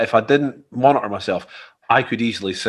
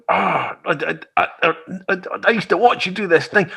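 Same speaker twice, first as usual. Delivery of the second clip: starstruck stammer; 7 semitones higher than usual. Retold or imitated.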